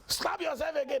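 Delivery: high-pitched mock command; bossy son shouting. Son bullying frightened robbers, high angry command.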